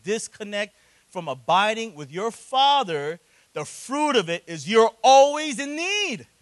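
loud, high, impassioned preaching; preaching at full force. Acted. Pastor preaching to the congregation about the prodigal son, fervent and loud. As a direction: drive the point home to the pews.

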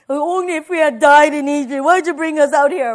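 loud, mildly mocking gripe; complaining. Israelites griping about leaving Egypt, complaining. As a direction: grumble out loud in protest. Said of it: "they're complaining".

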